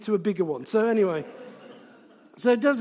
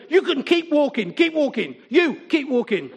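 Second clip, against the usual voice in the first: retold stern call, raised in pitch at first; barked order; 3 semitones higher, 3 dB louder than usual. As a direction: send them packing, repeating it firmly. Retold ordering fighters to walk away.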